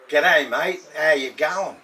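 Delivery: lively put-on Aussie greeting; Australian accent. Shown as a demonstration. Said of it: "we could talk like Australian at least".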